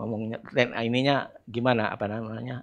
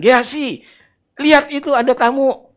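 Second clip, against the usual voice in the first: high, loud call; calling out to servant; 9 dB louder than usual. Retold or imitated. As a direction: call across to Gehazi.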